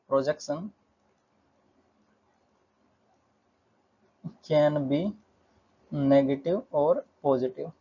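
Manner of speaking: slow, with long gaps mid-sentence; drawn-out board-writing pace; dictating while writing. Real, not acted. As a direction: dictate it in pieces as you write. Saying words while writing them out.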